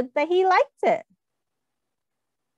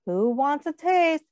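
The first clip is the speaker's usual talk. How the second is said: ordinary delivery, nothing marked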